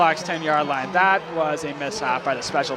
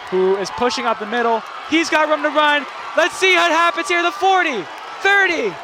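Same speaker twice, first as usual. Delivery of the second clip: higher and louder with every yard, ending in a full shout; rising touchdown call; 10 semitones higher, 5 dB louder than usual. Real, not acted. Announcer calling the kick return touchdown, building to a shout. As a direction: build from the catch to a roaring touchdown.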